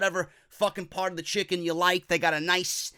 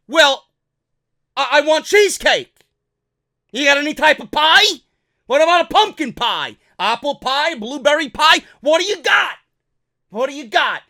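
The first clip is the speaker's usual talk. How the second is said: higher, louder demanding; pushy Kurt; 7 semitones higher, 8 dB louder than usual. Acted. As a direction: demand pie from the staff.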